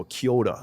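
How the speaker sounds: "kia ora" flowing as one word; "kia ora" blended into "kyo-da"; Māori pronunciation. Shown as a demonstration. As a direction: let "kia ora" roll, R tapped like a D. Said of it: "it's just like a flow".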